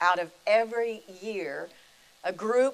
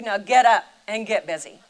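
loud command; 10 dB louder than usual. Retold or imitated. The voice of God commanding.

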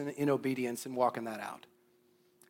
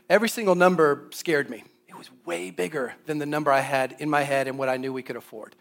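ordinary talk, nothing marked

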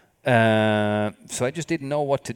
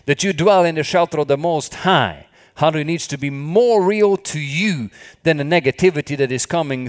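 loud, raised preaching voice; fervent preaching; 5 semitones higher, 6 dB louder than usual. Acted. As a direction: proclaim it to the congregation with fire. Preaching at full force.

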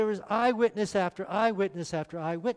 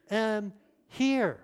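normal talking voice

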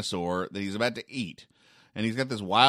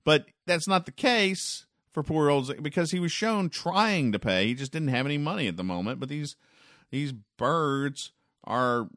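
higher, building indignant protest; mock indignation; 5 semitones higher than usual. Host defending Ziggy against rude birds, mock indignant. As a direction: huff on poor Ziggy's behalf.

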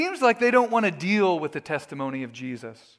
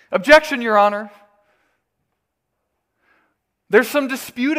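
mock lawyer objection; 3 semitones higher, 7 dB louder than usual. Mock courtroom objection by religious men.

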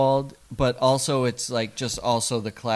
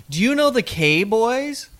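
mother voice; 8 semitones higher than usual. Retold or imitated. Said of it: "Let me th".

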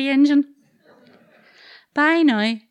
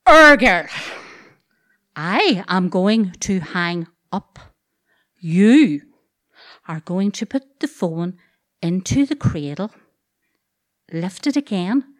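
slow, deliberate commands; slow firm orders; 4 semitones lower, 3 dB louder, slower than usual. Retold or imitated. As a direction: spell it out word by word. Brother gives firm step-by-step orders.

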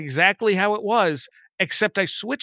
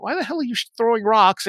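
higher-pitched, incredulous question; baffled retold challenge; 6 semitones higher than usual. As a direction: imitate baffled friends challenging him.

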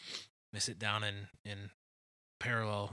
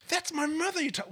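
Basil indignant; 7 dB louder than usual. Retold or imitated. Host quoting Basil defending his mother. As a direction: protest like an offended Englishman. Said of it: "Basil goes".

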